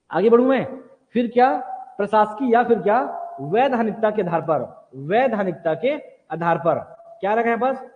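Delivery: normal talking voice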